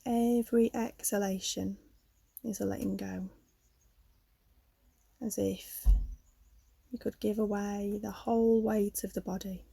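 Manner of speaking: calm yoga nidra guidance with long pauses; guided meditation. Real, not acted. Leads a yoga nidra relaxation.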